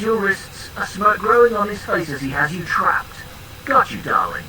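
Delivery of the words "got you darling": low, smug and satisfied; low smug gloat. Acted. Character pins opponent, gloating.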